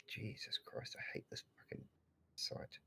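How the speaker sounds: muttered curse under breath; whispered cursing. Real, not acted. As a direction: hiss the swearing to yourself. Muttering frustration at website.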